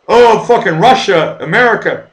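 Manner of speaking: loud, high mocking imitation; mock nationalist. Streamer mocking nationalistic viewers, loud mock chant. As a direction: sneer it like a flag-waving nationalist. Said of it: "You're fucking getting fucking nationalistic".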